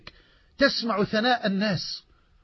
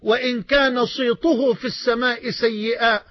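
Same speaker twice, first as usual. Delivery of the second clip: fervent hadith recitation; 4 semitones higher, slower than usual. Acted. Preacher reciting the Prophet's words to the congregation, slow and fervent. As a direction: proclaim each phrase slowly with mounting fervour.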